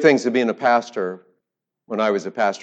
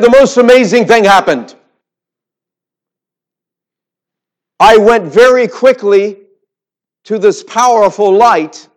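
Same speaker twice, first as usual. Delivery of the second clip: much higher, louder and slow with awe; excited old woman; 12 semitones higher, 14 dB louder than usual. Retold or imitated. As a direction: hold each word up like a marvel. Old woman describes the light.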